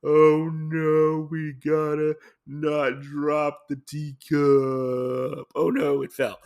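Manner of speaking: loud, drawn-out slow-motion cry; mock slow-motion panic. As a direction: stretch every word like film slowed right down. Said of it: "basically falling in slow motion, like".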